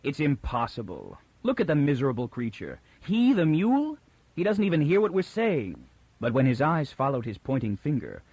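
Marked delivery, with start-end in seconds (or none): 0.0-5.9 scornful disbelief, jumping much higher on the mule; harsh and final. Acted. Toran scoffing at Bayta's accusation, harsh disbelief. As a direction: dismiss the idea with contempt. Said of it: "Toran said harshly and with finality".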